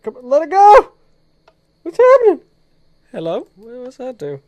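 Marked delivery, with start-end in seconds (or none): 0.0-2.4 loud, high, alarmed calls at the game; panicked yelling. Real, not acted. Gamer yelling at the stuck game, panicked. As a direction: yell at the game as it goes wrong.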